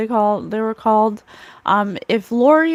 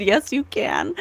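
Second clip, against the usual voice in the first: tearful laugh through the words; giddy quote from the spirit; 2 semitones higher than usual. Retold or imitated. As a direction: say it half laughing, half crying. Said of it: "Spirit said".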